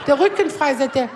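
normal talking voice

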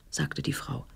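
whispered narration, no voice; hushed aside. A narrator's reading. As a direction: breathe the tag line softly.

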